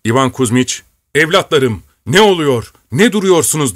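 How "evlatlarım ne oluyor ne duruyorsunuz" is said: high, urgent shout to the troops; commander's raised, pressing call; crying out. A narrator's reading. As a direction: cry out to rouse hesitating men. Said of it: "diye haykırdı".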